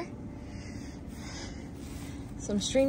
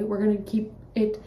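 ordinary talk

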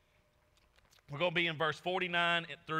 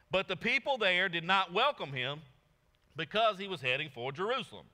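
raised, louder reading voice; proclaiming the verse; scripture reading; 4 semitones higher, 5 dB louder than usual. Acted. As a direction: read it out to the congregation. Reads scripture aloud.

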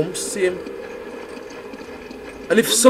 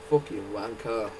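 ordinary talk

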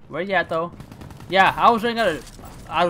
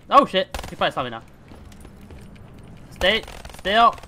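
ordinary talk, nothing marked

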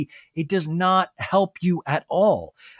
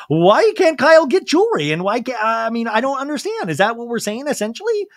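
pitched way up and louder, indignant mock whine; mocking Anna Marie; 6 semitones higher, 5 dB louder than usual. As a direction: parody her outraged questioning, high and loud.

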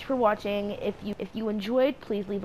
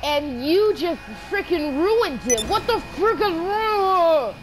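higher, louder hammed-up yell; mock rage; 7 semitones higher, 7 dB louder than usual. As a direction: blow up over the wrecked car. Game car gets wrecked.

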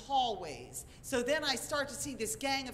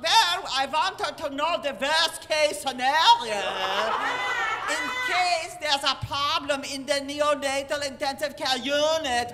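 hammed-up Germanic doctor, words heavily stretched; German-sounding accent; 3 semitones higher, 10 dB louder than usual. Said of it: "all I hear is German".

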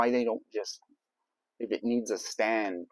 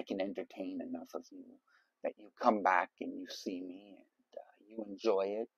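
whispered; 5 semitones lower, 9 dB quieter than usual. Real, not acted. Soft sincere thanks to viewers.

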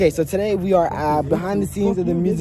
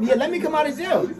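laughing mockery; 7 semitones higher than usual. Mocking rapper's plea.

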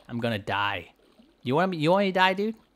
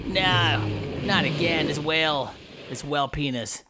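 normal talking voice

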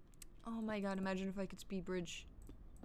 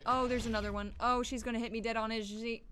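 ordinary talk

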